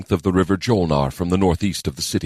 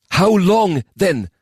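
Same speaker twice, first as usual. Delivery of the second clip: impatient lord; 13 semitones higher, 5 dB louder, much slower than usual. A narrator's reading. Voices Sajay.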